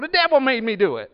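high, loud comic excuse, hammed up; Flip Wilson routine. Preacher imitating a TV comedian for the congregation, high and loud. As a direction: belt it out like a comedian's catchphrase. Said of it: "he would get up and he would go".

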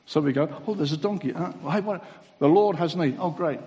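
loud, driving sermon delivery; full-force preaching. Acted. Preacher retelling the Passover story to the congregation, loud and driving. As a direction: preach it out to the back row.

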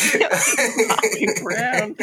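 drawn out through laughter; slow, amused mangling of the name; laughing. Real, not acted. Mocking the actor's name.

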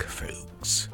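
usual speaking voice

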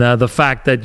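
ordinary talk, nothing marked

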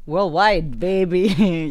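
loud, punchy hype shout; hyped catchphrase. Acted. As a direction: sell it like a show host. (frase enérgica de presentador)

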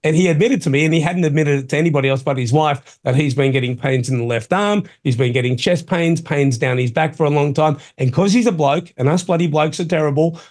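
usual speaking voice

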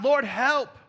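high, loud cry for help; preacher's acted shout of need. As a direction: cry out to God at full voice. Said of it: "when you cry out".

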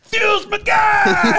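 very high, stretched-out mock yell; imitated fan scream. Superfan boasting after being pointed at, screaming. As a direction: shout it slowly like a proud superfan. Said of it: "He's still screaming at Fuel".

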